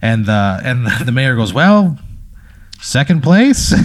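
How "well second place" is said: lightly acted higher-pitched official; a hint of a town official; mayor's announcement. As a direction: announce it with a touch of ceremony, pausing midway. Mayor announces result after the killing.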